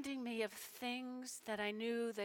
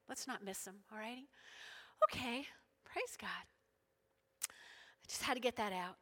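ordinary talk, nothing marked

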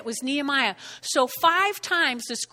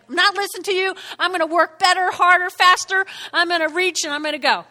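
loud, driving resolve; fired-up defiance; 4 semitones higher, 7 dB louder, faster than usual. Acted. Nehemiah refusing to listen to his opponents, fired up. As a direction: push each phrase out, pumped up.

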